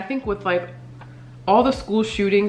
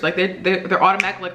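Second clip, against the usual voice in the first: ordinary delivery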